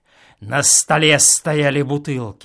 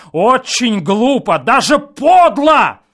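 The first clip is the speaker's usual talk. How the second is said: loud, higher indignant cry; indignant shout; 9 semitones higher, 10 dB louder than usual. A narrator's reading. Guest shouts his indignation at the neighbours. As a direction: lash out at them. Angry quarrel through wall.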